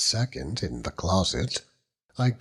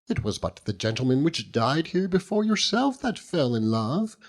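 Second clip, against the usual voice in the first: higher, gushing motherly housekeeper voice; fussing housekeeper; 5 semitones higher than usual. Acted. Housekeeper Mrs Crupp cheering lovesick lodger, higher gushing voice. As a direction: coax him like a nosy landlady.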